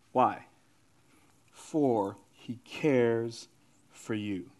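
low, soft, measured preaching with long pauses; hushed scripture delivery. Real, not acted. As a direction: lay the verse down gently, phrase by phrase. Preacher slows to deliver the verse.

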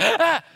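short, high, loud cry of alarm; startled mock-panic yelp; panicked shriek. Shopper spotting a limited supply on the shelf, panicked yelp.

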